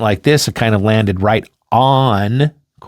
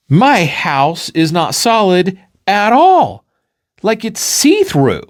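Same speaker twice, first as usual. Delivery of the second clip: amazed figure; 8 semitones higher, 3 dB louder, slower than usual. Acted. Flatland figure voiced in story.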